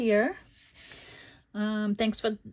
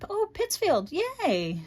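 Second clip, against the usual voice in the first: high-pitched, delighted little cheer; pleasantly surprised, high and excited; happy cheer; 12 semitones higher, slower than usual. Real, not acted. Delighted at viewer from Pittsfield.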